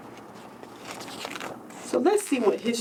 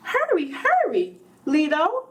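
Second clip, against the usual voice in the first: put-on much higher, impatient boy; urgent excited child; 5 semitones higher than usual. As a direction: pull grandpa along, can't wait. Child urges grandfather on.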